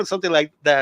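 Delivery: ordinary talk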